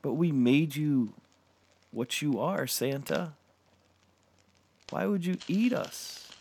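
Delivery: high, anguished creature voices; pleading birds. A narrator's reading.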